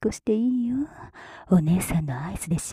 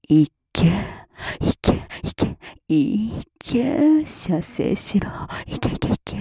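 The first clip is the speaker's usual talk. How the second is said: louder, forceful breathy urging; urgent command; 8 dB louder, slower than usual. Acted. Older-sister figure commanding the listener after a countdown, urgent and louder. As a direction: push each command out hard.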